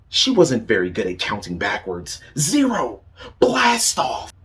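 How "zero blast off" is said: higher and louder launch cry; cried out. Acted. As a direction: shout it at lift-off. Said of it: "she cried".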